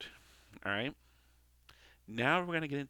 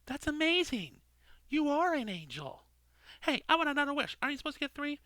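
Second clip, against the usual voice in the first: much higher, excited whoops; amazed Al; 4 dB louder than usual. Acted. Al marvels as the lights come on, excited and high. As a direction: gush like a kid at a miracle.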